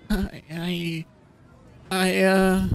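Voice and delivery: high, hesitant faltering; too scared to get the words out; frightened stammer. Acted. TJ frozen before boarding, stammering.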